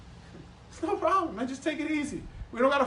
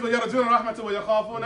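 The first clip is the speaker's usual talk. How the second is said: 1 quick Quran recitation; much faster than usual. Real, not acted. Reciting Quran verse fast.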